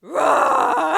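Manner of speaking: high, loud crying roar; dinosaur roar. Pretend. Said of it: "I'll do it too".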